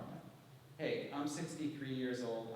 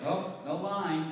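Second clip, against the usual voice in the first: wry deadpan; 4 semitones higher, 6 dB louder, much slower than usual. Retold or imitated. Wry reply to fans.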